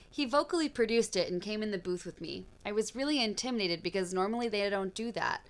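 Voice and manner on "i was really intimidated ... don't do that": ordinary talk, nothing marked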